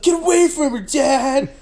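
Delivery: high-pitched hammed-up yell; mock protesting son. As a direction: call out like an upset son. Acts son defending woman.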